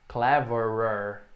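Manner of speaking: "Cleverer" said very slowly, each syllable clear; slow pronunciation model. Shown as a demonstration. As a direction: stretch out "Cleverer" for the learner. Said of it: "Um pouco difícil dizer". ("Cleverer" pronunciado bem devagar, sílaba por sílaba)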